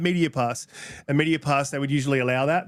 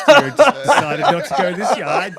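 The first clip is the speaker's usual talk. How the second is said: laughing; 4 semitones higher, 9 dB louder than usual. Real, not acted. Laughing while explaining Gaz.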